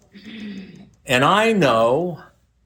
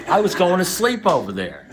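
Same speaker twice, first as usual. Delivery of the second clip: usual speaking voice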